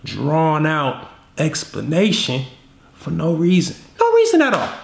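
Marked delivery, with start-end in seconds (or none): 4.0-4.7 mock frantic. Mocking the over-explainer.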